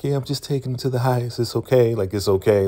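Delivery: high, quick mock-casual Ruth; breezy fake innocence; acting innocent. Ruth covering for her captor, mock casual. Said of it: "she's like".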